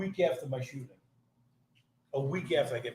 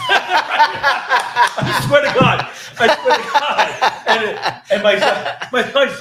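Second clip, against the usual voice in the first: high, loud, slow words through laughter; laughing; 11 semitones higher, 13 dB louder, much slower than usual. Real, not acted. Telling buddies the story, laughing hard. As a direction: swear it while cracking up.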